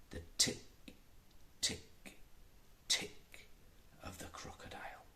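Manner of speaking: fading to a whisper; building dread as the crocodile nears; hushed suspense. A narrator's reading. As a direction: let it creep in quietly.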